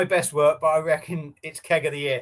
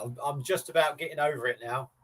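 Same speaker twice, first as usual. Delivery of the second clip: ordinary talk, nothing marked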